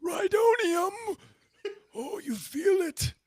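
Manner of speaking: high shouted, then slow and high; mock villain high on fumes, shouting then slow; clown villain. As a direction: ham it up like a high-pitched madman. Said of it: "I can do this clown".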